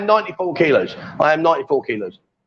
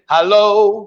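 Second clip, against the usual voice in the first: louder, drawn-out hello; 3 semitones higher, 4 dB louder than usual. Shown as a demonstration. Coach leading Linda in a hello, loud. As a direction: call it out for a learner to copy. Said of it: "you gotta speak loud".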